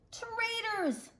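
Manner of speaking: storybook shark king yelling in fury; shouted. Acted. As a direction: hurl the word at the traitors. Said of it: "the king shouted".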